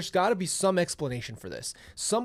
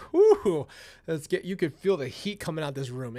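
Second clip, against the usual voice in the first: ordinary talk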